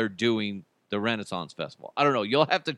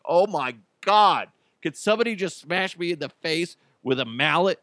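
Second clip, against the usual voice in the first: raised, exasperated retelling; mock exasperation; 6 semitones higher than usual. Reacting to a coworker's dialect, mock exasperation. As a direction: groan it out, fed up with her act.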